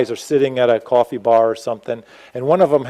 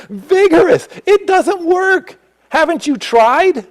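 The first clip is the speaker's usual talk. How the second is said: fired-up preaching; 11 semitones higher than usual. Real, not acted. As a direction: rouse the silent crowd. Preacher urging blank-staring congregation.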